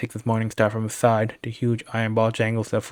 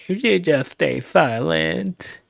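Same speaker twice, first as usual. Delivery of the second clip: very slow, high sneering goblin voice; menacing goblin; 6 semitones higher, much slower than usual. Acted. Goblin leader to captured thief, slow high menace. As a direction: drag each word out as a threat.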